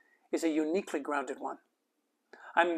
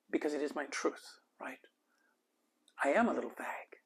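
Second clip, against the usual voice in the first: hushed, softer confession; whisper; 2 semitones lower, 8 dB quieter than usual. Real, not acted. Author confiding his truth to the audience, whispered. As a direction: let it drop into a near whisper.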